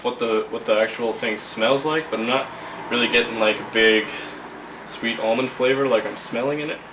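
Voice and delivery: lips clamped on mouthpiece; muffled speech around stem; talking around pipe in mouth. Real, not acted. Seen 0: Mouthpiece held between lips from 2.6s; not in mouth earlier.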